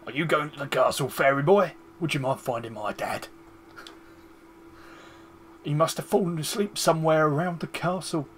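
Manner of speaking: put-on a light Cockney accent, cheerful and chatty. Ranch girl asking the boy to find her dad, Cockney accent. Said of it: "you wanted a Cockney accent?".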